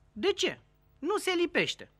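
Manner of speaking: pitched well up, puzzled; high questioning voice. Acted. Clerk and Ceaușescu bickering, voice pitched high. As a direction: ask it high and innocent.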